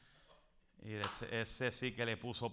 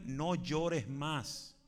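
"No llores más" stretched out very slowly; slow, tender command "No llores más"; slow, drawn-out quote; 3 semitones higher, 3 dB louder, much slower than usual. Acted. Preacher quotes Jesus, drawn out.

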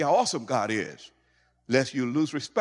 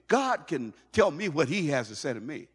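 ordinary talk

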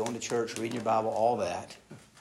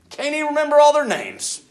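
excited preaching; 13 semitones higher, 11 dB louder than usual. Real, not acted. Preaching climax.

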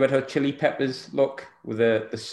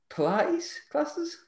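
mocking; 14 semitones higher, 5 dB quieter, much slower than usual. Real, not acted. Teasing about Bobby's Pilates.

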